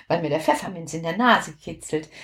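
ordinary delivery, nothing marked

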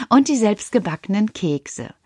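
usual speaking voice

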